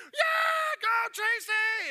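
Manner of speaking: high, drawn-out full shout; crowd cheering. Acted. Whole crowd urging Tracy on, loud high cheering. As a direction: yell it like a roaring crowd. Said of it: "entire crowd jumps up and goes".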